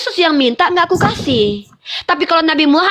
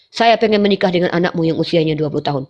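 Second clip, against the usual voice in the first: low mock god voice; 9 semitones lower, faster than usual. Voices God asking for daughter.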